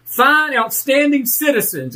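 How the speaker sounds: ordinary talk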